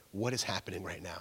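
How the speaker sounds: low, hushed displeasure; unhappy girlfriend. Retold or imitated. Girlfriend confronting her boyfriend at late night, quietly unhappy. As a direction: imitate a girlfriend quietly unimpressed. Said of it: "do you think she was happy?".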